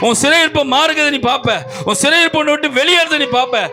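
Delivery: high, unrelenting preacher's cry; full-force exhortation to a congregation; fervent preaching. Real, not acted.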